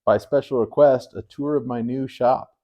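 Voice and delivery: usual speaking voice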